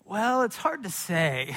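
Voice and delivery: put-on slow, drawn-out pondering toddler; mock pensive. Toddler answering dad's question about mommy, mock pensive. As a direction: stretch it out as if weighing it carefully. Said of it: "he gets this really pensive look".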